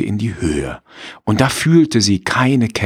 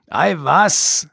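raised, higher scornful farmer voice; gruff farmer; 9 semitones higher than usual. Acted. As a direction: brush her off as the farmer. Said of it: "sagt der Mann".

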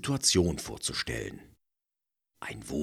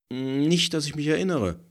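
mischievous; 10 semitones higher, 4 dB louder, faster than usual. A narrator's reading. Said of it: "lächelt verschmitzt".

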